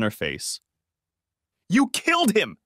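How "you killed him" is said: screamed. A narrator's reading. Tabby screams at the man she blames, high-pitched. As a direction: scream it at him, high and raw. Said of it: "she screamed".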